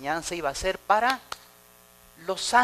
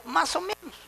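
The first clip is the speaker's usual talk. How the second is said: high, shrugging "más o menos" voice; mocking a lukewarm member who shrugs everything off; mock lazy answer; 10 semitones higher than usual. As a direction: play the half-hearted believer. Mocking a mediocre churchgoer.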